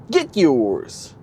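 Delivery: hard sell ad. Pretend. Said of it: "I don't mean to sound like a, a car salesman here".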